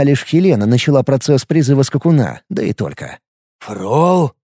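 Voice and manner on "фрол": surprised voice from inside a helmet; muffled under helmet. Acted. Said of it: "раздался удивлённый голос из-под шлема".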